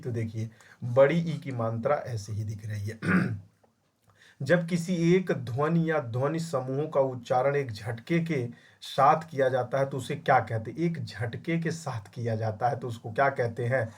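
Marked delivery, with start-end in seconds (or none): none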